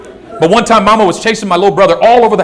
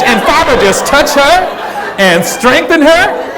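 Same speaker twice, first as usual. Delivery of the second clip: higher-pitched, sweetly resumed prayer; mock praying mom; 6 semitones higher, slower than usual. Mom resumes praying after the porch threat, high pleading voice. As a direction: slip straight back into pleading prayer, slowing at the end.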